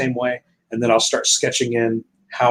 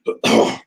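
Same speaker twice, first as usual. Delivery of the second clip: sharp cough from choking. Real, not acted. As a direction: cough hard, water down the wrong pipe. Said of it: "choking on water".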